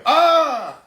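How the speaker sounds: loud, high drawn-out scream; long high cry of pain; imitated yell. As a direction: scream like someone just got tased. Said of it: "I heard somebody yell".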